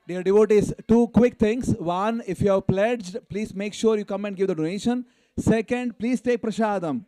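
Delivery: calling out. Real, not acted. Host telling the devotees after the program, loud call. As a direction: project it to the back of the hall. Announcing to the whole hall.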